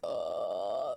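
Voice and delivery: very low guttural groan; deepest growl. Shown as a demonstration. Streamer showing chat the deepest note in their register. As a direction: drop as low as the voice goes. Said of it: "That's the deepest I can go".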